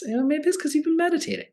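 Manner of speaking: a friend pointing out the obvious, in a high teasing voice; high mock voice. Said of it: "And someone else points out".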